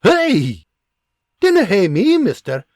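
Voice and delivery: a wife scolding her husband for a cruel prank; angry scolding. Acted. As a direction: give him what for.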